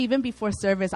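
usual speaking voice

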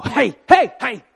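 overexcited, high, loud greeting; hammed-up zealot, jumping in loud and high; crazy new believer. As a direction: pounce on a friend with wild-eyed eagerness. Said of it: "they kinda look a little crazy".

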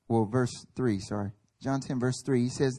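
ordinary delivery

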